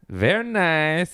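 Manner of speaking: slow, high-pitched Borat catchphrase; Borat accent. Retold or imitated. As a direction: stretch it out in a mock Borat accent. Borat catchphrase after discussing him.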